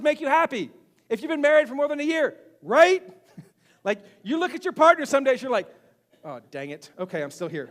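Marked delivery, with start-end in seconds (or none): 6.2-7.7 low, soft disappointed grumble; mock resignation. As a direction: play a spouse quietly resigned. Acts spouse let down by partner.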